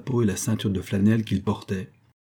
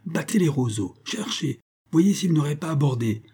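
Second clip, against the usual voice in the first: higher called-out commands; shouted orders; 6 semitones higher than usual. A narrator's reading. Lady calls orders to her troop on the bank, raised and high. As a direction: call across to the men on the shore. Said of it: "elle cria".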